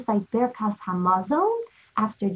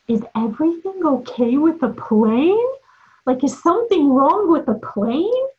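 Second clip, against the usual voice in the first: higher, louder anxious question to a seatmate; worried passenger; 6 semitones higher, 4 dB louder than usual. Retold or imitated. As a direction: ask nervously if the plane is in trouble. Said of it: "she turns to my father and she says".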